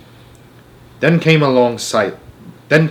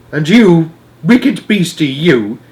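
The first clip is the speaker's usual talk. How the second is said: high, louder rebuke; scolding cry; 5 semitones higher, 5 dB louder, slower than usual. A narrator's reading. Giant scolds the nightmare in the jar, loud and high. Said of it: "he cried".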